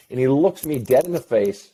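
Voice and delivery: ordinary talk, nothing marked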